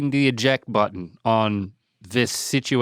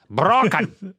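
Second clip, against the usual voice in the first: higher-pitched Irish put-on; hammed-up Irish lilt; playful Irish accent; 6 semitones higher than usual. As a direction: echo it in a mock Irish accent. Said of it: "You gotta use an Irish accent".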